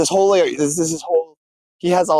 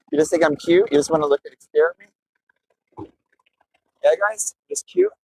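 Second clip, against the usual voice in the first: playful teasing; 4 semitones higher than usual. Real, not acted. Teasing chat viewers.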